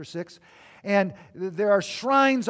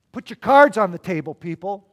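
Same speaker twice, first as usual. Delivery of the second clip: commanding call; 9 dB louder than usual. Retold or imitated. Jesus voiced as demanding all.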